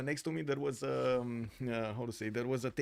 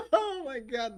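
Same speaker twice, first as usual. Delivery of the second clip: "oh my god" through laughter; laughing; 12 semitones higher, 9 dB louder, slower than usual. Real, not acted. Streamer laughing about the driver's oi. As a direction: laugh it out, higher.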